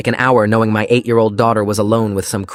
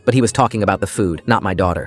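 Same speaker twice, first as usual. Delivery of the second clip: ordinary delivery, nothing marked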